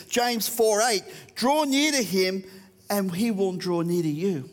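usual speaking voice

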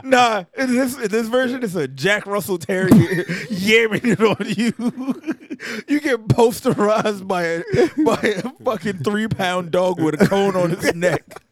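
high, laughing through words. Real, not acted. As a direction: giggle while joking.

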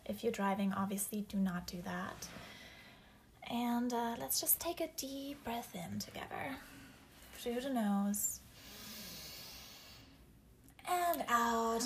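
slow, whispered meditation voice; guided meditation. Acted. Leads grounding meditation.

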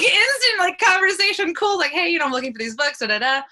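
high, loud, gushing rush; excited retelling. Real, not acted. Retells instant friendly conversation.

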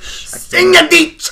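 very high, loud mock-German shout; slow, over-the-top German bellow; hard German accent. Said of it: "do German and do it as, like, as, as hard as possible".

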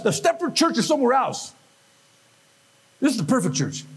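ordinary delivery

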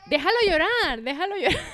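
high, loud singsong heckle; exaggerated high-pitched holler; mock calling out. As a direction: belt out the unwanted advice mockingly. Mockingly calls out the unwanted advice.

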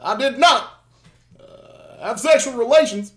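halting Obama cadence, each phrase held apart; Obama impression. Shown as a demonstration. Host tops his friend with a halting Obama voice. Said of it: "I got one, I got one".

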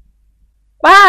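high, loud drawn-out "ป้า"; loud call. Acted. Boy calling out loudly to his aunt. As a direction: call out to auntie. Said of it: "ร้องขึ้นดังๆ".

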